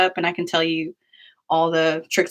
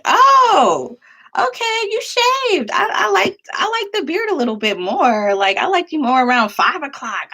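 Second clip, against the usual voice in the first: high, sassy teasing voice, much higher; obnoxious teasing; 10 semitones higher, 4 dB louder than usual. Retold or imitated. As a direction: needle him about the shave in a sassy high voice. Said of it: "We were, like, obnoxious like that".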